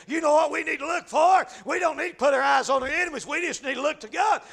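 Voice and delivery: ordinary talk, nothing marked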